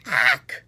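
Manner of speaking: sharp loud low "Ack!"; startled farmer's shriek. Acted. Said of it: "shrieked the farmer".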